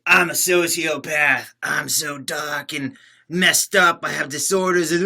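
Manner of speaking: slow, whiny mock of a self-proclaimed sociopath; mocking edgelord. Streamer mocking edgelords who claim sociopathy, whiny parody. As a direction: drawl it like a fake tortured teen. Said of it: "You're like".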